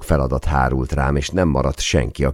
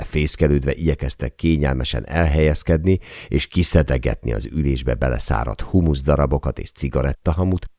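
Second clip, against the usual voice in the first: plain reading voice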